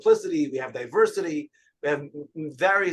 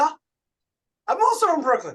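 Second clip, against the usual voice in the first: high, loud, startled delight; amazed; 11 semitones higher, 4 dB louder, faster than usual. Retold or imitated. Rabbi meets a fellow Brooklynite, high amazed surprise. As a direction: act stunned by the coincidence.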